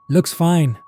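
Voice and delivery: short, loud grunted words; gruff grunt. A narrator's reading. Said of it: "Mark grunted".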